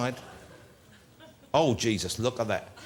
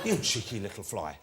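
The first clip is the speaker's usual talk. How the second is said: mock scolding; 6 semitones lower, 5 dB quieter than usual. Host scolding fly, low and soft.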